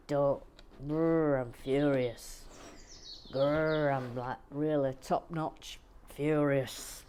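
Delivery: half-serious growly fury impression; mock furious growl. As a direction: grumble grr then I'm furious like a gruff actor. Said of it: "Something like that".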